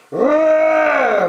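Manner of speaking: loud, high, hammed-up roar; cranky bear roar. The cranky bear roars at the friends, loud. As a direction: roar to chase them off. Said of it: "went the cranky bear".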